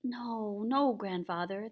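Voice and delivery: plain reading voice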